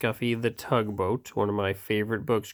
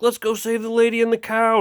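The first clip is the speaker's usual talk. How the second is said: high rally call; 11 semitones higher than usual. Acted. Rescuers rallying.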